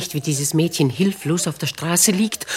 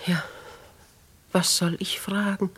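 hesitant whisper; 6 dB quieter than usual. Acted. Lady hesitates to question girl.

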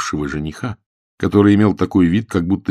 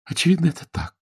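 sighing; 11 semitones higher, faster than usual. A narrator's reading. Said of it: "вздохнула Джен Олифант".

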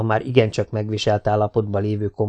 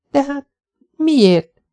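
put-on higher, sharper young woman's protest; indignant surprise; 5 dB louder, much slower than usual. A narrator's reading. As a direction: push back in disbelief. Jessica protests. (felháborodott meglepetés)

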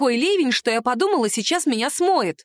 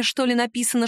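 plain reading voice

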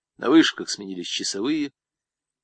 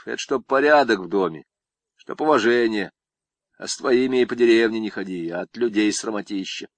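plain reading voice

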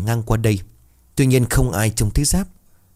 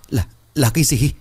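higher-pitched, halting stammer; faltering fearful question; 5 semitones higher than usual. A narrator's reading. Companion too scared to finish asking, faltering high voice. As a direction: barely get the question out. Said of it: "Mấp máy hỏi được vài chữ".

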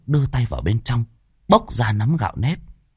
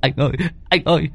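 fast, much higher, panicked pleading; terrified wail; 10 semitones higher than usual. Acted. Hưng tells his boss something bit off his leg, terrified wail. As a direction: gasp it out in pain and terror.

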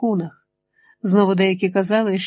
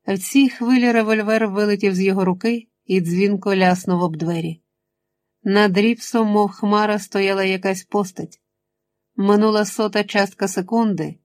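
ordinary delivery, nothing marked

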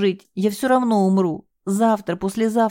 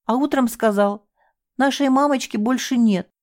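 high, raised, bitter confession; anguished outcry; 7 semitones higher than usual. Acted. Девушка с надрывом рассказывает следователю об отчиме. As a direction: hurl the truth at her.

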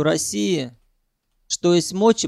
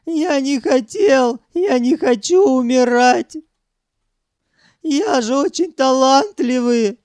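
high, loud self-pitying wail; sobbing; 8 semitones higher, 5 dB louder than usual. A narrator's reading. Sanyok begging not to die, sobbing. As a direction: plead tearfully, stretching the words. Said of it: "зарыдал Санёк".

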